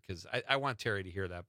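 ordinary talk

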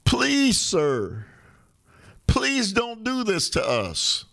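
high plea falling away at the end; mock begging. Host acting out Republicans begging him not to run, mock pleading. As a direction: plead with a high, stretched please, hammed-up. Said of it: "they're begging him".

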